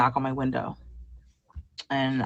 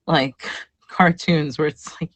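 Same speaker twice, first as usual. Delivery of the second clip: ordinary talk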